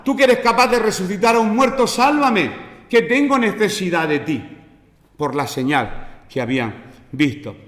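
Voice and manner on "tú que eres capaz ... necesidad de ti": high, raised cry for salvation; crowd's plea. Acted. Preacher voicing the Jerusalem crowd pleading to Jesus, high and urgent. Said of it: "Eso estaba diciendo la multitud".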